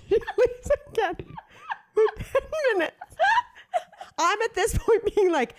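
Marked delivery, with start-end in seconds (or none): 0.0-3.0 high-pitched, helpless laughing while telling it. Real, not acted. Telling the co-host she left again, cracking up. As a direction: squeeze the words out through real laughter, slowing right down. Teller cracks up at own story.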